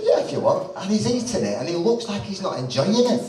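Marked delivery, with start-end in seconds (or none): 0.0-0.7 laughing reply. Retold or imitated. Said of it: "I said".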